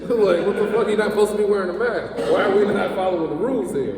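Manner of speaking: high, loud mock outrage; indignant. Real, not acted. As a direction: rant with outrage. Indignant rant.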